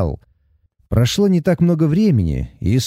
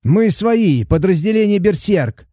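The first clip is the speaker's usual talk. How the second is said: shouting; 7 semitones higher than usual. A narrator's reading. Shouting to sailors amid gunfire.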